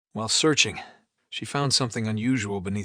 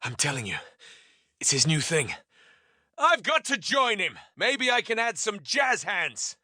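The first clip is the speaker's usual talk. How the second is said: enthusiastic; 8 semitones higher than usual. Acted.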